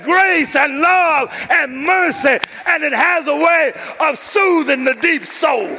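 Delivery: loud, high, impassioned sermon cry; full-force preaching. Real, not acted. Preacher urging congregation toward Father God's arms, full-force preaching. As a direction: build to a preacher's soaring shout, stretching key words.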